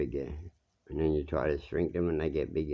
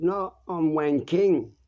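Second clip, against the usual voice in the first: indignant correction; 10 semitones higher, 5 dB louder, slower than usual. Retold or imitated. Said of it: "turned around and said".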